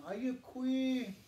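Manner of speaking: slow, carefully modelled Ayukwi; Yurok greeting. Shown as a demonstration. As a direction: say Ayukwi clearly for learners to repeat. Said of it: "So everyone".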